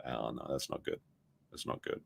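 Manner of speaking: very soft, low aside; hushed dismay. Real, not acted. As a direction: trail down almost to a whisper. Dismayed reaction to the statement.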